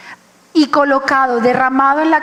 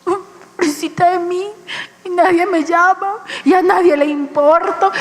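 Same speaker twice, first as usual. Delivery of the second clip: higher, tearful whimper of self-pity; mock self-pity; 4 semitones higher than usual. Preacher mocks her own depressed self, tearful whimper. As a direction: whine like a poor forgotten soul. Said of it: "¿yo qué hacía?".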